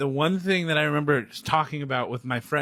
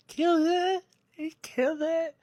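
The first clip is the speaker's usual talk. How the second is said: excited; 13 semitones higher than usual. Real, not acted. Gushing over Chris Evans.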